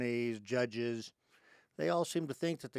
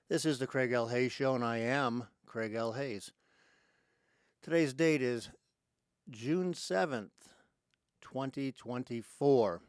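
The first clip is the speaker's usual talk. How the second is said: loud, punchy radio host greeting; show-opening announcer; 5 dB louder than usual. Real, not acted. Host greeting listeners all over the planet, loud announcer delivery. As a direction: announce it big, pausing between phrases.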